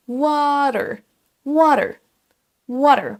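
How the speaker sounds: drawn-out American water; American accent. Shown as a demonstration. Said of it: "avec l'accent américain".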